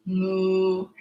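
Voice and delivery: low soft cow moo. Pretend. Kids yoga cat cow sounds.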